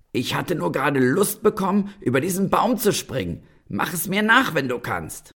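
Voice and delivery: high, cocky and bragging; boastful challenge. Acted. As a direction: dare him, full of swagger. Tailor boasts to the giant.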